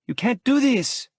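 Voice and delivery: woman protesting as he walks out; angry Lola. A narrator's reading. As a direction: hurl it at him in protest. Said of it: "Lola says angrily".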